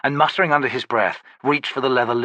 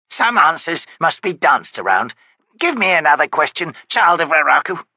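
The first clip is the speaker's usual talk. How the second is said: put-on old witch; 6 semitones higher, 5 dB louder than usual. Witch grumbling at her visitor, high crone voice. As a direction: croak it like a cranky hag.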